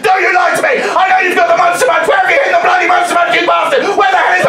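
high, very loud angry yelling; furious drunk customer. Retold or imitated. Drunk woman accusing the shop worker of lying, furious shouting. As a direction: yell accusations at full volume, high-pitched.

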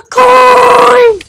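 high, stretched-out shout; gasp, then draw the word out with glee; gleeful cry. Real, not acted. Player spotting a coin in the game, gleeful cry.

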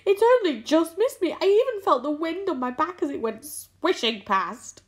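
high, agitated lament; wailing. A narrator's reading. Earthworm shaken by the near miss, wailing. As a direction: keep wailing, pitched well up. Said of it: "wailed the earthworm".

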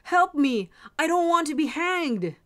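loud, high plea for help; crying out. A narrator's reading. Condemned soldier calling his three dogs, crying out. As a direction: cry out desperately for rescue. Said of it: "cried the soldier".

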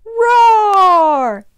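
high, loud, long tiger roar; playful roar. Pretend. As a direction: roar along with the children. Invites listeners to roar along.

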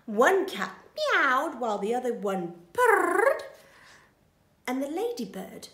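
plain reading voice

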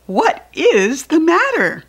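high, loud worried question; concerned parent. Acted. Parent reading to children, a high loud worried question. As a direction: ask what's wrong with sudden concern. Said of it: "said Large".

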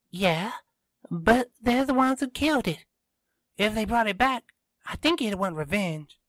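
put-on high, brisk girl character voice; Kiara arguing, insistent. A narrator's reading. Kiara disputes the robot's ending with Tristan, high insistent voice.

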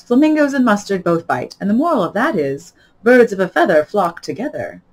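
loud, lilting Duchess voice; moralising Duchess. Acted. Duchess finding a moral for Alice, loud and lilting. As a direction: pronounce the moral with relish, loudly.

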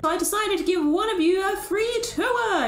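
high, showy prize announcement; grand robot benefactor. Acted. Robot offering villagers a free tour, showy. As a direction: build up to the prize like a host.

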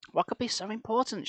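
demanding. A narrator's reading. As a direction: press her for an answer. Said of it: "she demanded".

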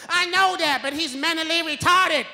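grandma voice. Retold or imitated. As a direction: snap back like grandma. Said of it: "And I was like".

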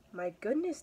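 a storyteller's amazed cat; startled cat. A narrator's reading. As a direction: exclaim in mild astonishment. Startled cat's thought in story.

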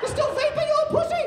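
high, loud mock boomer rant; angry old man yelling. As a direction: scream at the kids like a furious old man. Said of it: "I get into it".

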